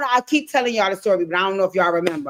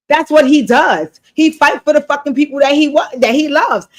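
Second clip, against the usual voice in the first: louder, higher indignant outburst; exasperated rant; 5 semitones higher, 5 dB louder than usual. Real, not acted. Ranting at Damien over his excuse, loud and indignant. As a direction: push it out in disbelief.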